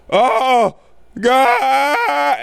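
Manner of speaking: high, loud, hammed-up cry of horror; a villain's mind snapping, played for laughs; mock shattered gasp. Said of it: "And he goes".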